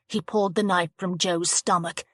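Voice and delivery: ordinary delivery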